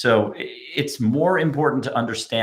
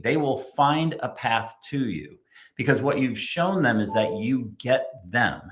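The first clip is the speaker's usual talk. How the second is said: usual speaking voice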